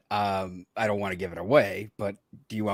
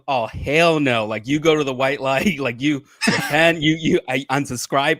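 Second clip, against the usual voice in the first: mock panicked wife; 5 semitones higher, 11 dB louder than usual. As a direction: ham up her loud freak-out while laughing through it. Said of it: "she was freaking out".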